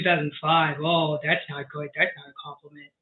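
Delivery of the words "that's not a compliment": normal talking voice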